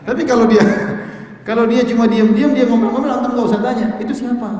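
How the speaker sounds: giggling as he makes the point; laughing. Real, not acted. Preacher amused by the silent friend example, laughing.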